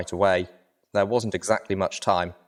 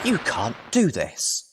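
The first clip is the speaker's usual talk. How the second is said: higher, slow, drawn-out objection; indignant protest; 6 semitones higher, slower than usual. Acted. Velvet Remedy objects to the plan, slow indignant protest. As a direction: plead it slowly in outrage. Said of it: "Velvet Remedy snorted and stomped".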